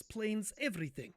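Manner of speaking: ordinary talk, nothing marked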